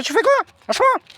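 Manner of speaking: re-enacted high-pitched outcry; acted protest. Protesting while being strangled on the floor.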